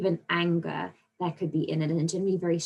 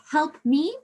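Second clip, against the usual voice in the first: higher, louder cry for help, demonstrated; exclaimed command; 9 semitones higher, 5 dB louder than usual. Shown as a demonstration. Teacher reading out an example command to pupils, exclaimed. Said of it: "you're commanding them to help you".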